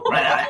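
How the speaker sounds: loud mock heave; one noisy cartoon retch; imitated vomiting. Friend being sick into bag, mock vomiting.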